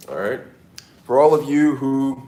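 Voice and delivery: ordinary talk, nothing marked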